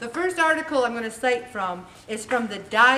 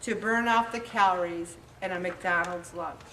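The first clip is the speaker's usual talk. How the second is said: usual speaking voice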